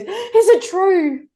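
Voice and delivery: put-on very high, slow child's question; wide-eyed kid asking, drawn out; little girl asking. Daughter in the car asking mum, high and slow. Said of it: "and she goes".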